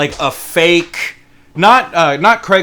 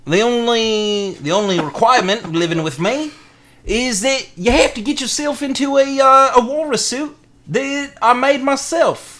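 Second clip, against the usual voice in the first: high, slow, hammed-up Australian; drawn-out mock Australian pitch; amateur Australian accent; 8 semitones higher than usual. Fake ad explaining the walrus suit rule, hammed-up Australian accent. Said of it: "that's all I have for Australian".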